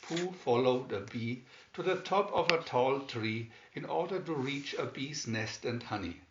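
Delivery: ordinary delivery, nothing marked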